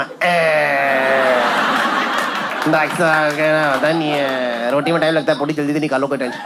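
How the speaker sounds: lightly voiced older mother; mother's voice. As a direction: play the carefree mom brushing off the doctor.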